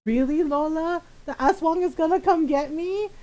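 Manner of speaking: scared little brother. Retold or imitated. Scared brother questioning Lola about the aswang, mock fearful. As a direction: ask it as a mildly frightened kid. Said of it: "he was really scared".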